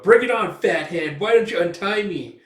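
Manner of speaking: high, loud cocky taunt; defiant shout. Acted. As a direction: yell it right in the thug's face. Elf defies the thugs.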